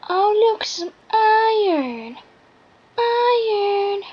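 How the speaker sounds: high, loud delighted cry; thrilled discovery; real excited find. Real, not acted. Excited at finding iron.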